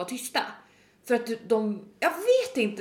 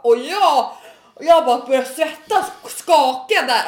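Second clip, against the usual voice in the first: ordinary talk, nothing marked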